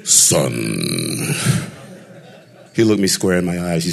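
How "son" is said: very low, breathy-dark father's "Son"; deep Darth Vader voice. Retold or imitated. Father calls his son in a very low Vader voice. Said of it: "Darth Vader".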